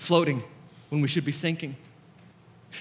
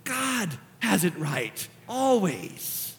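sudden soft, slow delivery; hushed conviction; 5 semitones higher, 5 dB quieter, slower than usual. Real, not acted. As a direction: drop to a hush and let it sink in. Sudden hush after impassioned preaching.

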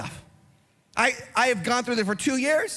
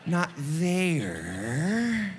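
slow, softer mock-incredulous sarcasm; sarcastic retort; 4 semitones lower, 4 dB quieter, much slower than usual. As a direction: drag it out to make the rule sound absurd. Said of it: "tempted to say".

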